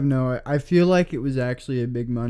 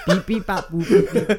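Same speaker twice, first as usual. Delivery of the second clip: beeping robot noises with a laugh; 3 semitones higher than usual. Retold or imitated. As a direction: beep like a broken robot. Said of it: "that voice for that robot".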